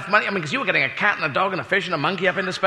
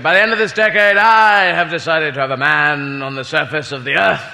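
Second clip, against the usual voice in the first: mock prime-ministerial speech; Harold Macmillan; 3 semitones lower than usual. Prime minister announces a modest space goal, mock grand oratory. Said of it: "he made a speech where he said".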